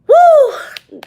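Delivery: loud, high whoop letting off steam; sharp woo of exasperation. Real, not acted. Remembering the cashier, a loud high woo. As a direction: blow off the anger with a yelp. Venting anger after retelling.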